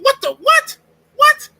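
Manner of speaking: very high, loud disbelief; incredulous outburst. Acted. Man reacting to police questioning him in the park, incredulous outburst.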